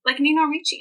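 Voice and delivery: high, amazed retelling of someone's reaction; mock surprise. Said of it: "And they were like".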